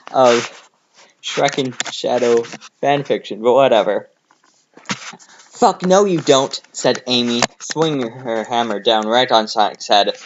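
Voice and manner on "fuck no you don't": slow, high furious voice; angry. A narrator's reading. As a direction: drag it out in rage. Amy attacks Sonic.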